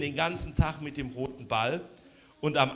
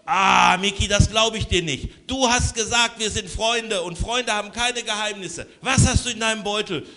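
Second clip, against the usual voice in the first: accusing; 6 semitones higher, 4 dB louder than usual. Acted. Lubo accuses his friend.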